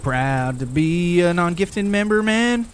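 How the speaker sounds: stingy chatter. Retold or imitated.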